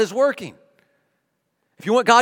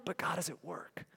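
hushed whisper; 16 dB quieter than usual. Real, not acted. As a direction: whisper the refrain softly. Repeated refrain, hushed.

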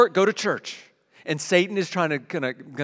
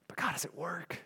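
hushed whisper; 14 dB quieter than usual. Real, not acted. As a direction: whisper the refrain, almost unvoiced. Repeated refrain, dropped to hush.

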